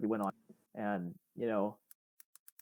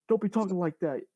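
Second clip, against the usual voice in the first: imitating a scolding bus driver, pitched up; mock telling-off in a raised pitch; retold yell; 8 semitones higher, 4 dB louder than usual. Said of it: "yelled at me".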